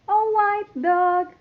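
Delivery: ordinary talk, nothing marked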